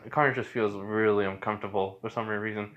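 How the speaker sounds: plain reading voice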